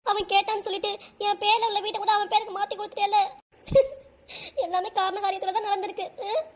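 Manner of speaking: high-pitched tearful lament; acted crying. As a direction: wail it as a hurt, disbelieving mother. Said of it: "இப்ப ஏன் அதையே சொல்லி அழுதுட்டு இருக்க".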